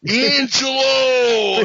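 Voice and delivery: loud, slow, drawn-out Italian shout; Italian greeting shout. Acted. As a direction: belt the name like a Brooklyn Italian.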